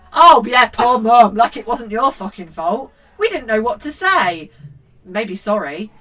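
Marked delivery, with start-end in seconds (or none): 0.1-2.9 loud, scornful echo; sarcastic. Real, not acted. Mother sneering at the child's line, sarcastic.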